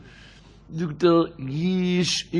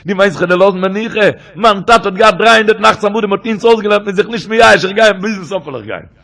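impassioned plea; 2 semitones higher, 8 dB louder than usual. Retold or imitated. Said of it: "sogt Reb Nachman".

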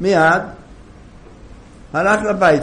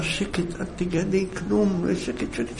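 whispered, much softer hush; 8 dB quieter than usual. Acted. Husband hushing his wife so neighbours won't hear. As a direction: keep it secret, whisper. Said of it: "אמר לה".

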